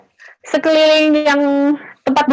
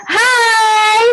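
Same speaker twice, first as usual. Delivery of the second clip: shouted greeting; 8 semitones higher than usual. Shown as a demonstration. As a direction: call out hi to wake the room. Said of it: "Sekali lagi ya".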